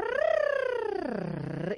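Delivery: a long high rolled rrrr. Shown as a demonstration. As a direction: trill the R and hold it. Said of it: "Lo siguiente sería una R".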